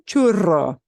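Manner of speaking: churro with a trilled rr; rolled r. Shown as a demonstration. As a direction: roll the rr in churro. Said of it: "Churro is a super popular pastry item".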